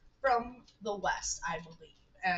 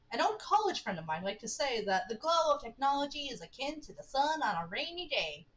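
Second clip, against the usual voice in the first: put-on higher, lilting southern accent; 4 semitones higher than usual. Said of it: "That was my southern accent".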